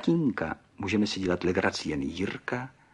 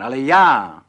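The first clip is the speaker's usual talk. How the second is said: much louder, higher proud cry; triumphant exclamation; 5 semitones higher, 17 dB louder than usual. A narrator's reading. Pan Burgán names himself as the model, exclaiming. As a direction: burst out with self-pride. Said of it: "zvolal a ukázal na sebe".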